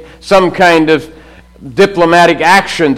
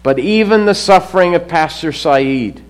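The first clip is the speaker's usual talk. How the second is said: ordinary talk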